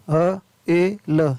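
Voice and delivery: slow, separate phonics sounds; word broken slowly into its sounds; sounding out. Shown as a demonstration. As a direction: say each sound on its own. Phonics teacher sounding out spelling.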